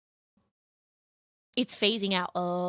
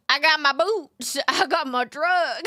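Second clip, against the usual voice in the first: a light hammed-up Southern accent; mock Southern country; 4 semitones higher than usual. Streamer repeats her country bit, in a mild mock Southern accent. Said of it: "cringe Southerner accent".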